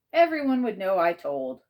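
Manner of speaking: ordinary delivery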